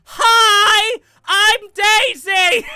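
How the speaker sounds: shrieked high, slow princess chant; Daisy voice. Retold or imitated. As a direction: screech it like a gloating Princess Daisy. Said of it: "her fucking chant".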